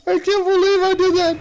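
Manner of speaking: high, squeaky laughing words. Real, not acted. Gamer still cracking up at own mistake, laughing high.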